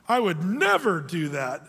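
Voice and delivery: "never" drawn out slowly with a pitch swoop; slow, mock-solemn vow stretching "never"; emphatic denial. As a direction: lean long and hard on "never". People's confident denial, acted out.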